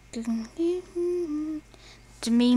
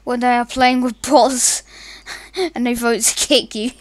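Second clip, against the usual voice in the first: ordinary talk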